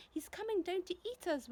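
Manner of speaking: put-on high, wailing old grasshopper; hammed-up frightened wail. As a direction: wail in mock panic. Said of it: "wailed the old green grasshopper".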